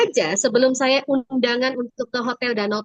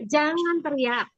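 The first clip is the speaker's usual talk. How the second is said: mother's scolding; 4 semitones higher, slower than usual. Retold or imitated. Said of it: "Kan mamanya bilang".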